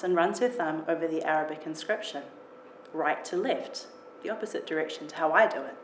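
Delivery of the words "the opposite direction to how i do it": plain reading voice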